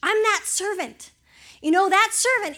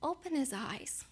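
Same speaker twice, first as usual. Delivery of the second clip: slow, softer, hushed prayer; 3 semitones lower, 14 dB quieter than usual. Retold or imitated. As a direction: pray it gently and slowly. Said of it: "That's what he prays".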